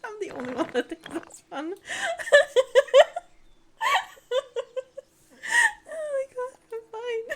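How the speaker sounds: high, breaking into loud real laughter; crying from laughing. Real, not acted. Streamer insisting they are fine while crying from laughing. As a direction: gasp it out between teary laughs. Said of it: "I'm literally crying from laughing".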